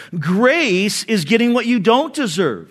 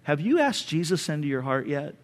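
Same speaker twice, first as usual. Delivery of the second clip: soft, quiet, unhurried question; dad calmly asking his son, quiet and gentle; 5 semitones lower, 9 dB quieter than usual. Retold or imitated. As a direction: ask it gently, no anger. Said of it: "looked at me very calmly and he said".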